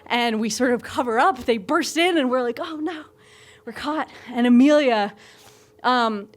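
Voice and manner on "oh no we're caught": hushed dismay. Retold or imitated. Couple caught by parents, acted.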